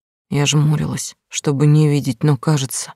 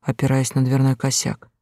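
usual speaking voice

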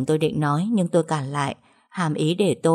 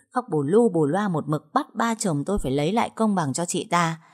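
ordinary delivery

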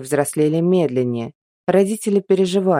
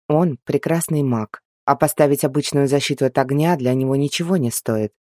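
ordinary delivery, nothing marked

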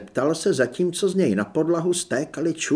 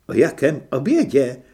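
innocent; 8 dB louder than usual. Acted. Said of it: "s nevinným výrazem".

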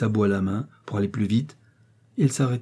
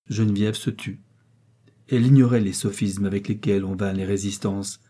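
usual speaking voice

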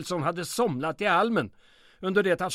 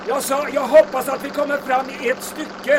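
higher, louder yell over the engine; shouting over wind; 9 semitones higher, 7 dB louder than usual. Acted. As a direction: call out above the rushing wind. Repeats louder, not heard.

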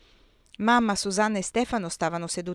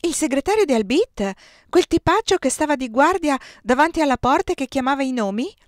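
louder and higher, jumping in eagerly; excited; 6 semitones higher, 6 dB louder than usual. A narrator's reading. Susanna jumping up at the table, excited. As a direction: leap up with excitement. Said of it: "saltò su eccitata".

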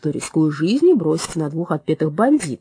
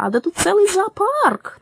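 high, slow, amazed cry; amazed exclamation; 13 semitones higher, 4 dB louder, slower than usual. A narrator's reading. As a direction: marvel at a whole zoo. Parrot exclaims at the ward.

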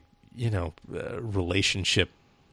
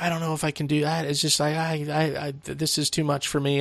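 put-on high, fast, distressed girl on the phone; mock upset girl's voice; frantic girlfriend voice; 8 semitones higher than usual. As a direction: play the panicking girlfriend, pitched up. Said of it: "it's like".

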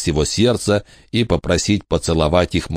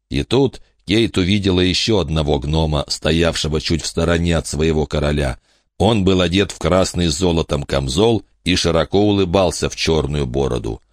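ordinary delivery

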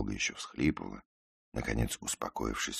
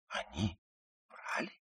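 hushed, stunned question; astonished whisper; 9 semitones higher, 8 dB quieter than usual. A narrator's reading. Ruth learns her admirers may have lied, astonished whisper. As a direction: whisper it in disbelief. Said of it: "удивлённо прошептала она".

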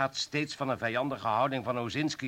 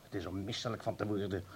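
much softer, lower, full of disgust; low disgusted aside; 6 semitones lower, 11 dB quieter than usual. Acted. Superior rebukes the official in a low disgusted voice. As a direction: drop your voice in contempt.

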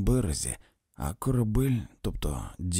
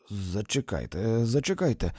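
ordinary delivery

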